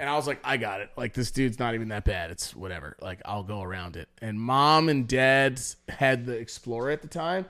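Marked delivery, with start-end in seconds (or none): 0.4-4.1 low, soft mutter to himself; casual self-assurance. Retold or imitated. Driver telling himself it's fine, nonchalant and low. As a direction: shrug it off quietly, trailing lower.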